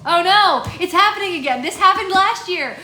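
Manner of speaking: high, loud, hammed-up wail; mock despair. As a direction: wail at your own failing brain. Mock dismay at slipping accent.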